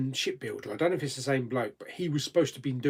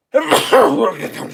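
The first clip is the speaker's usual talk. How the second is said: stifled sneeze; 13 semitones higher, 14 dB louder than usual. Real, not acted. Fighting off a sneeze while eating.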